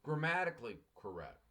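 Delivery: ordinary delivery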